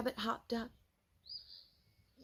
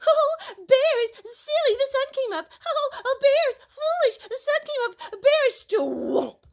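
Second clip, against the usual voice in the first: high, loud mocking singsong; little squirrel teasing Bear; 9 dB louder than usual. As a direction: taunt Bear in a squeaky sing-song. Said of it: "began to tease Bear".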